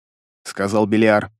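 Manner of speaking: usual speaking voice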